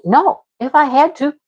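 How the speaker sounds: fed-up retold protest, rising in pitch; exasperated protest. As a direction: insist it just won't get done. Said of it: "I'm like".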